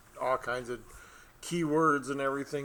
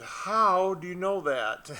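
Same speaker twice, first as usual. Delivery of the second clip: astonished; 7 semitones higher, 6 dB louder, slower than usual. Retold or imitated. Astonished slow quote.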